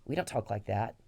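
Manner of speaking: normal talking voice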